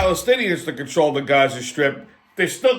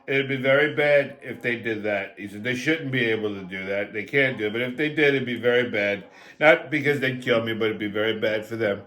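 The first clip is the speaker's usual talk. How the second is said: Trump impression; 9 semitones lower, 3 dB quieter than usual. Comic telling a crowd about Trump's Gaza plan, in a low Trump voice. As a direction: slide down into a Trump drone. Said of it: "He goes".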